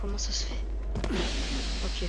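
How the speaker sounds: hushed whisper. Real, not acted. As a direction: whisper it under your breath. Hiding, prisoner gone.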